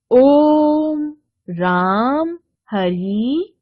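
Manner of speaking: ओम, राम, हरि with each vowel drawn out long and slow; slow, drawn-out chant-like vowels; prolonged pluta vowels. Shown as a demonstration. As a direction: stretch the vowels as if calling from far. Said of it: "दूर से पुकारने और गाने में इनका प्रयोग".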